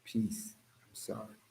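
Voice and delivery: barely audible whispered apology; calm. Acted. Answering those who want a reaction, calm whisper. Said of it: "I'm actually practicing to be calm".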